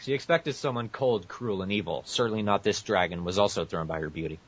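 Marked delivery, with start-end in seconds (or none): none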